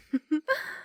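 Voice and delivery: high, short gloating laugh; taunting laugh. Acted. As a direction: gloat at his tears. Vampire taunts shivering boy.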